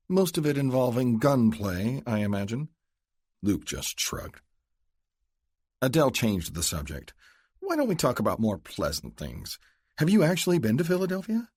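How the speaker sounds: change the subject brightly; Adele, probing. Acted.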